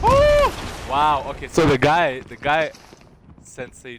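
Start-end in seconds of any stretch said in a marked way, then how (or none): 0.0-0.9 sudden high, loud "oh"; high shout of surprise; startled cry. Real, not acted. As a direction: yelp out in shock. Startled during play.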